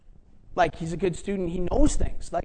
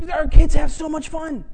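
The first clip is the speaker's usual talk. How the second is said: excited exclaim; 8 semitones higher, 9 dB louder than usual. Real, not acted. Preacher building up excitement.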